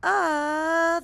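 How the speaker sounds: high, loud mock scream of a falling skier. Teacher voicing the skier falling off the mountain, loud scream. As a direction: yell it like you've just gone off a cliff. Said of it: "he screams".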